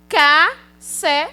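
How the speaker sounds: "Ca-se-co" slowly, each syllable on its own; syllables said separately. Shown as a demonstration. Teacher offering syllable choices to pupils, slow separate "Ca-se-co". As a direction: sound out "Ca-se-co" piece by piece. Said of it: "falada separadamente chama-se sílaba".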